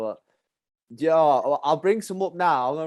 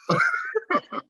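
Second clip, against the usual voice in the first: high-pitched laugh. Real, not acted. Laughing at the list item.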